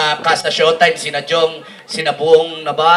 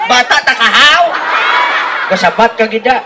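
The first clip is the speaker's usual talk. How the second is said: scolding parent; 4 semitones higher than usual. Acted. A parent scolding the child in Ilonggo. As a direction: scold the kid sharply. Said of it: "iba-ibang boses".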